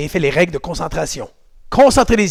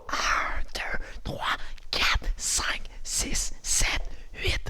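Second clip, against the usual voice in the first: hushed, measured count to eight; whispered count; 12 dB quieter, much slower than usual. Shown as a demonstration. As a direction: whisper each number crisply. (compte chuchoté, bien scandé)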